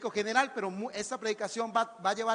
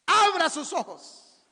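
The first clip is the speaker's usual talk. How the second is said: commanding call; 6 semitones higher, 5 dB louder, much slower than usual. Acted. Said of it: "preacher commands congregation".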